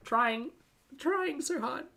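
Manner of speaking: wet mouth sounds; struggling with wet mouth noises. Real, not acted. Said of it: "apologies if you're getting wet mouth sounds".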